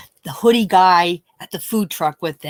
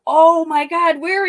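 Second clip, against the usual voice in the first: high, loud alarm; shocked; 9 semitones higher, 5 dB louder than usual. Retold or imitated. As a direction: gasp it out in shock. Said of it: "I'm like".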